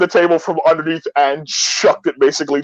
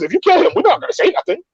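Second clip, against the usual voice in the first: high, hurried imitation of a driver; conspiring taxi driver; 13 semitones higher, faster than usual. Taxi driver promising the tourists silence, high-pitched mock assurance. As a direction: rush it out in a put-on high voice.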